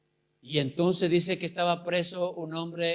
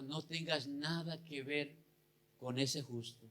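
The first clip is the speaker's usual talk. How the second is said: soft and hushed, much quieter, as if into an ear; hushed aside; 13 dB quieter than usual. Retold or imitated. Pilate's wife warning Pilate in his ear, hushed. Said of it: "le dijo al oído a Pilato".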